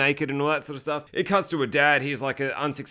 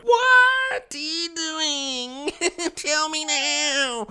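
wailing disbelief; 13 semitones higher, much slower than usual. Retold or imitated. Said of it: "it's real fucking like".

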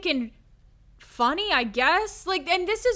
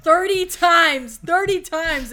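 slow, louder disbelief; incredulous outcry; 2 semitones higher, 6 dB louder, slower than usual. Real, not acted. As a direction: drag out the number in outrage. Incredulous about the gun.

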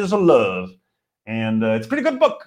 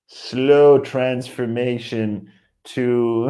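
very slow, stretched-out words; laboriously drawn-out delivery; slow transformation; much slower than usual. Acted. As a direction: drag every word out as if gradually morphing. Enacting the gradual change.

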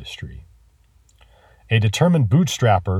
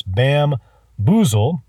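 bamboozle drawn out very slowly; slow, relishing bamboozle; slowly savoured; 4 semitones higher, much slower than usual. Shown as a demonstration. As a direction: let bamboozle roll off the tongue, stretching every sound. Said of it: "let it roll off your tongue".

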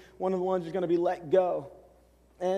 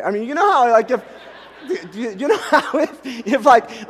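laughing; 4 semitones higher, 8 dB louder than usual. Real, not acted. Laughing while telling.

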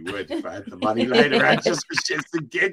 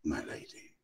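slow, low, hushed romantic English gentleman; lower, slower, hushed lovesick poet; very English farewell; 10 semitones lower, 5 dB quieter than usual. Pretend. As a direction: woo her softly like a courtly Englishman. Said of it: "say bye to Vicky in the very English way".

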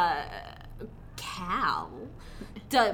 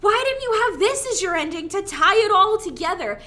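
high, loud exasperated protest; mock exasperation; 9 semitones higher, 6 dB louder than usual. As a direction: ask it like a fed-up moviegoer. Mock exasperated viewer reaction.

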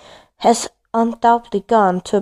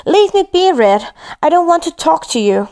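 angry yell; 7 semitones higher than usual. A narrator's reading. Girl yells at her brother to leave her be, angry. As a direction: push him away with a shout.

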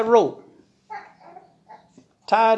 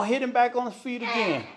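ordinary delivery, nothing marked

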